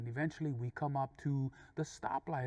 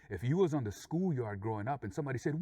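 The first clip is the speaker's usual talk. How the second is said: ordinary delivery, nothing marked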